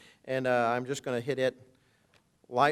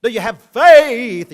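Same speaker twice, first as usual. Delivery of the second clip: mock questioner; 8 semitones higher, 13 dB louder, slower than usual. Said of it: "it can be corrupted".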